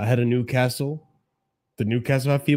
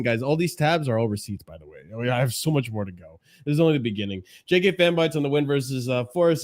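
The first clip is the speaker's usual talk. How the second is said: normal talking voice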